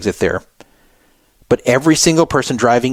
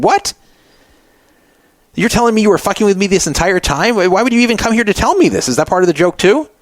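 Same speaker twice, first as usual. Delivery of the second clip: shocked disbelief; 8 semitones higher than usual. Retold or imitated. Learns it was a prank.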